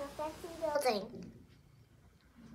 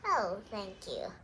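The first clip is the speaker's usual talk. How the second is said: bashful thanks; 7 semitones lower, 4 dB quieter, slower than usual. Acted. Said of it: "Mayor Bloom blushed".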